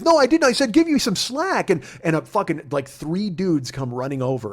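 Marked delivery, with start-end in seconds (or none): 0.0-1.7 higher, louder, huffy; indignant denial. Retold or imitated. Agent denying the slack instruction, huffy and high. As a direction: deny it, offended. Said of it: "He goes".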